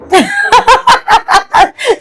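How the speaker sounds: shrill burst of teasing laughter; high giggle. Real, not acted. Laughing at Shawa while teasing him. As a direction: laugh high at his expense.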